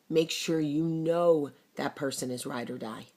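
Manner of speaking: ordinary talk, nothing marked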